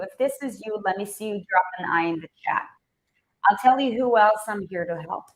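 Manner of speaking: usual speaking voice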